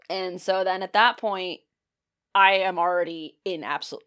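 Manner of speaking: normal talking voice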